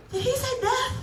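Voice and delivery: high, laughing disbelief; disbelieving. Retold or imitated. Rewinding the ad in disbelief.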